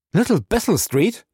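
high, rising echo of disbelief; startled question. Acted. Captain jumping up at the street name, startled. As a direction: repeat it sharply upward as a question.

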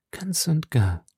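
breathy whispered confession; a soft intimate whisper to her lover. Acted. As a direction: breathe it like a secret. Milady's hushed intimate reply.

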